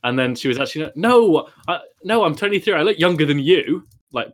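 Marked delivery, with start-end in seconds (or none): 1.0-3.9 put-on high, insistent drunk girl voice; mock indignant girl. Drunk girl insisting her age.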